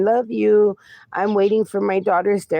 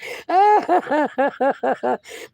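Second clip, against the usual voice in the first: high, rolling real laugh; real high-pitched belly laugh; burst of laughter; 7 semitones higher than usual. Real, not acted. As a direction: crack up laughing for a couple of seconds. Laughs at a chat comment.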